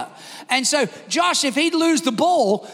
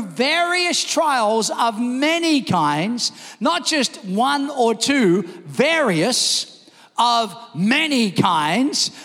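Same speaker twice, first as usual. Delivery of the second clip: slow, deliberate, each word weighted; slow emphatic; much slower than usual. Real, not acted. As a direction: drag it out so it lands. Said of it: "preacher slows to drive home".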